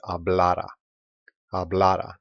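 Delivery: hablara with the middle syllable stressed, ha-BLA-ra. Shown as a demonstration. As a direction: model hablara clearly, weight on the middle a. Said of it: "the stress is on this letter A".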